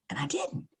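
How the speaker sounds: breathy whisper of denial. Retold or imitated. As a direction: whisper it, almost to yourself. Quietly insisting she didn't call.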